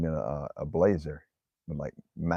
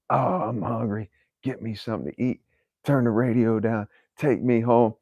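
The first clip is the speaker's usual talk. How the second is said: higher-pitched whiny demands, getting louder; mimicked complaining; 5 semitones higher, 4 dB louder than usual. Hungry father-in-law nagging his driver, mimicked complaining. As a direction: pile up the gripes like a nagging passenger. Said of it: "Buddy keeps complaining".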